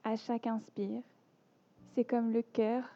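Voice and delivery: measured yoga nidra voice with long pauses between phrases; guided meditation. Acted. Teacher guiding listeners lying down into yoga nidra, calm meditation voice.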